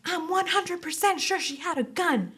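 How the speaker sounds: high-pitched imitation of an adamant witness; mock insistent witness. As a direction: mimic her swearing she's sure, voice way up. Mimics insistent witness on the stand.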